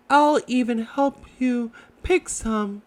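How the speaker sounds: high little Piglet voice, stammering; timid Piglet. Acted. Piglet answers Roo, high and shy.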